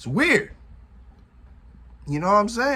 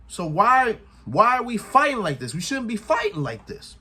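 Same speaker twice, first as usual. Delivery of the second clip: higher-pitched, acted-out appeal to a brother; pleading protest; 6 semitones higher than usual. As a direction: plead as Jay, voice lifting. Acts out Jay confronting his brother.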